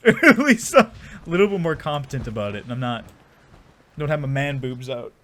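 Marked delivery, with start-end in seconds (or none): none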